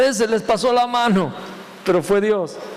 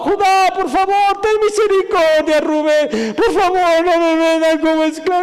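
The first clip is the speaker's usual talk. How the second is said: high, loud desperate pleading; pleading scream; 8 semitones higher, 4 dB louder, slower than usual. Acted. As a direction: beg for your life, crying out. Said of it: "No lo escuchábamos gritando". (súplica desesperada, aguda y fuerte, casi llorando)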